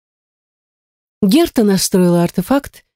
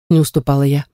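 usual speaking voice

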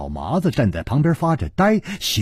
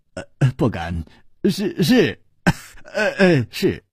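acted awkward laugh between short words; laughing reply; 4 semitones higher than usual. A narrator's reading. Xue Bin deferring to his host, laughing awkwardly. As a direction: stammer agreement through little laughs. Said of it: "哈哈笑道".

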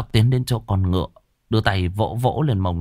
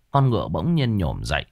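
ordinary delivery, nothing marked

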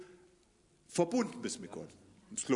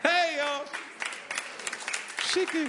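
calling out; 10 semitones higher than usual. Real, not acted. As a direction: shout out to get attention. Calling out to someone.

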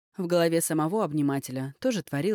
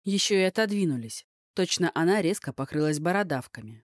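ordinary delivery, nothing marked

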